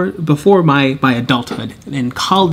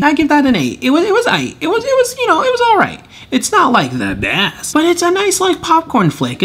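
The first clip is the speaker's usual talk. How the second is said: high-pitched; 12 semitones higher than usual. Real, not acted. Lukewarm verdict in high voice.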